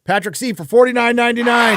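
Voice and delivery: high, loud announcer call; announcer shout. Acted. Host shouts the donor's name and amount. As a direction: call it out like a game show host.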